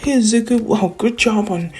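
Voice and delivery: high-pitched mock girl voice; light, high and chirpy praise; friendly congratulation. Uraraka congratulating Izuku on passing, friendly and high.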